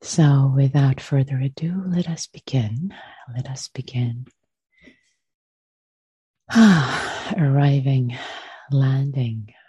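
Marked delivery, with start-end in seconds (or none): 1.9-9.5 unhurried meditation voice with long pauses; guided meditation. Acted. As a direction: gently guide them into stillness, leaving space after each phrase.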